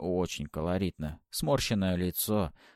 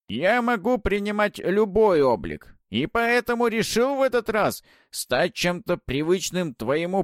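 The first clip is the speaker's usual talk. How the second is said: высокий громкий скрипучий голос ведьмы; хвастливо, как старая ведьма; голос Бабы-Яги; 10 semitones higher, 6 dB louder than usual. Acted. Баба-Яга объясняет свой облик. (скрипучий голос Бабы-Яги)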